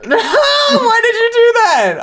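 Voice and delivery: high squeal through laughter; giggling high-pitched why did you do that; laughing. Real, not acted. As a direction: laugh out the question in disbelief. Laughing at wrong link posted.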